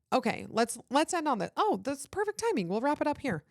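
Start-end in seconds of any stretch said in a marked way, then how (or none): none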